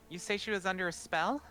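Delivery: high-pitched questioning voice; inquiring healer. Acted. Helper examining Ophelia, high-pitched and inquiring. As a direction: ask lightly in a high register. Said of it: "And she kinda goes in".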